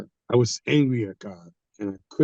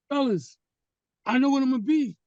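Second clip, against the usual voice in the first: excited announcement; 12 semitones higher than usual. Retold or imitated. Announcing his calling to friends.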